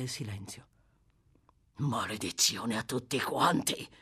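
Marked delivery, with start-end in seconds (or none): 1.8-3.9 low, muttered curse, understated; a hint of a bitter oath; cursing. Sykes curses his silent companions, a mild low curse. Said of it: "inveì Sykes".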